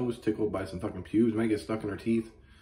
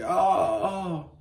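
much higher mock moan of pleasure; hammed-up moan; 12 semitones higher, 5 dB louder than usual. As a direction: overact a fake moan, pitched way up. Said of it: "She's like".

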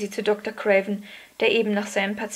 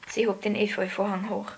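ordinary delivery, nothing marked

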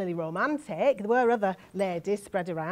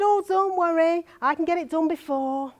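higher, louder, insistent promise; quoted reassurance; 7 semitones higher, 4 dB louder than usual. Acted. Young woman assures relatives about the pregnancy, insistent. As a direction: quote yourself promising to fix it. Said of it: "I said".

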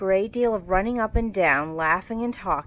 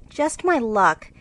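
louder, higher, distressed apology; groaning; 7 semitones higher, 5 dB louder than usual. Acted. Jo apologising to her sister, groaning. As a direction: rue the mess aloud. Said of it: "groaned poor Jo".

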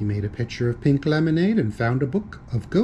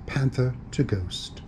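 plain reading voice

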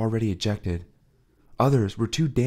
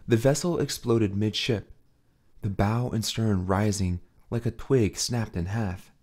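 ordinary delivery, nothing marked

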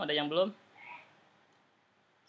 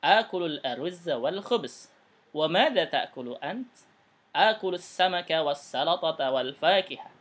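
louder, slow teacher reading with clear question and answer tunes; a touch slower and louder, each line shaped to its tune; model reading; 5 dB louder, slower than usual. Shown as a demonstration. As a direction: read the dialogue aloud as a model for the class. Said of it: "sesuaikan dengan nadanya".

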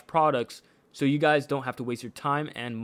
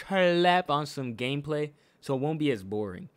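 normal talking voice

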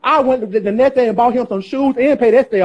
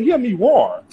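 ordinary talk

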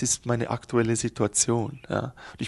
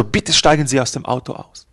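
stern policeman; 8 dB louder than usual. Acted. Policeman orders him out.

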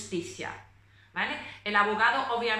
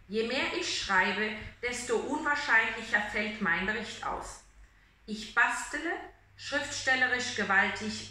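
reading aloud; 2 semitones lower than usual. Shown as a demonstration. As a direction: read the passage like a recital. Reads German literary passage. (lectura literaria pausada en alemán)

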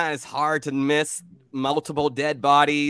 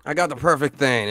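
loud, high burst easing into usual pitch; eager barkeep; 5 semitones higher, 9 dB louder than usual. Acted. Barkeep Jack answering a customer's order, eager and loud.

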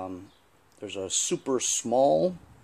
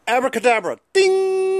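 higher, louder showman flourish; kids party magician; 7 dB louder than usual. Pretend. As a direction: announce the trick with a grand ta-da. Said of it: "The magic of Hollywood".

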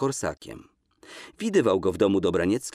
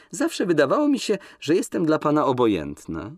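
much higher, coquettish pout; lightly voiced young woman; 7 semitones higher, 3 dB louder than usual. Woman provoking a reluctant man, coy and higher. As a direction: fish for a compliment.

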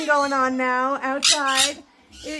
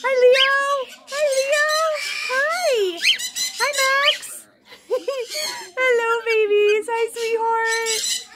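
delighted pet greeting; 9 semitones higher, slower than usual. Real, not acted. Owner greeting her babies, delighted cooing. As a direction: gush high to beloved pets.

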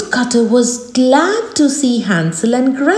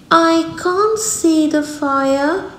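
put-on higher girl's voice; little girl voice; 7 semitones higher than usual. A narrator's reading. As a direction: play innocent. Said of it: "said Gretel".

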